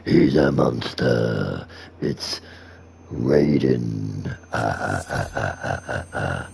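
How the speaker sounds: very low, slow monster voice; deep demon with a drawn-out evil laugh. Acted. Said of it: "do the demon voice".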